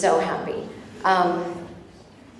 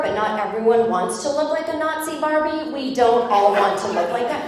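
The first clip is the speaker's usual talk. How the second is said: sassy comeback; 4 semitones higher than usual. Retold or imitated. Imagined snappy comeback.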